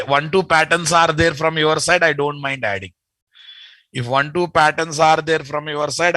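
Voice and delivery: ordinary delivery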